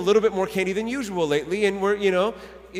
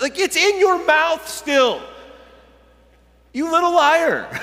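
high, loud comic exasperation; mock accusation; 9 semitones higher, 5 dB louder than usual. Dad catching his kid with chocolate, mock-outraged accusation. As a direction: call out the fibber, pitched way up.

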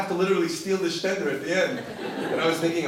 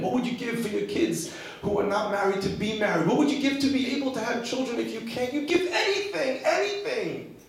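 impassioned appeal; 8 semitones higher than usual. Real, not acted. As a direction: pitch climbing steadily to a fervent high cry. Sermon builds to an impassioned climax.

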